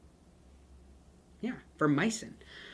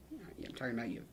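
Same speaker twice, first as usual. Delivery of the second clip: low-volume, half-whispered callout; mock stern aside; 3 semitones lower, 12 dB quieter than usual. Teacher singling out the student who says it wrong, hushed mock callout. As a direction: drop to a hushed, pointed aside.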